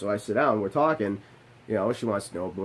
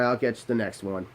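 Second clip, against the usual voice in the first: ordinary talk, nothing marked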